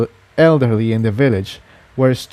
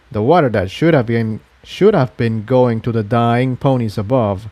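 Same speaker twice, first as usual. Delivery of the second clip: usual speaking voice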